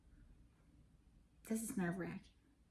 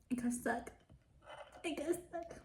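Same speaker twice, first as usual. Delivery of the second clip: surprised; 6 semitones higher, 4 dB quieter than usual. Real, not acted. Food stuck, voice jumps high.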